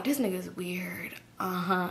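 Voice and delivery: quiet aside in the car; muttered under breath. Retold or imitated. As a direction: mutter it to yourself so he can't hear. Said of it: "But I ain't said it".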